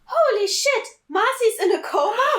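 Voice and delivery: high, loud cry of shock; shocked shout. Acted. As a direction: blurt it out in disbelief. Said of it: "I shouted in shock".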